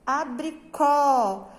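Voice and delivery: "abricó" slowly, with a long, open final ó; teacher's slow model "abricó", last syllable stressed and open; open stressed vowel. Shown as a demonstration. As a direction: stretch the open ó at the end of "abricó". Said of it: "A pronúncia deve ser aberta".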